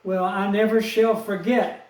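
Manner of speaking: ordinary talk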